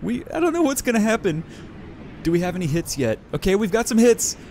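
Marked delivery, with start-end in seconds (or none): none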